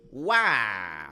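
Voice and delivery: mock orc war cry. Shown as a demonstration. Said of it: "so it's very polite".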